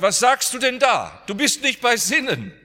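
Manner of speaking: a frantic mother crying out; retold scream. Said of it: "Und sie schrie und sagt". (verzweifelter Schrei, viel höher und lauter)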